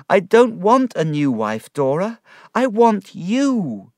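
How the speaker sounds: loud, high, impassioned protest; crying out. A narrator's reading. David tells dying Dora he wants only her, crying out. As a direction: plead at full voice, drawing out the last words. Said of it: "I cried".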